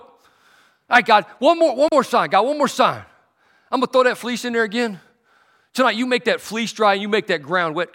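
loud, high, pressing demand; insistent plea. Acted.

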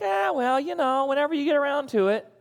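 a careless God waving it off, high and loose; lazy indifferent God. Retold or imitated. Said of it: "God would just be like".

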